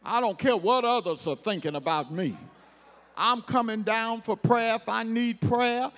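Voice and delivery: ordinary delivery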